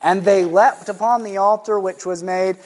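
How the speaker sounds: usual speaking voice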